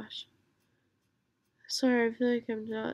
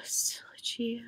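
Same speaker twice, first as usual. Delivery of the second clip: whisper. Real, not acted.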